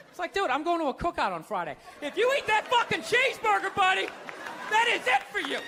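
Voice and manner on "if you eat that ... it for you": mock priest threat. Priest threatening about cheeseburger.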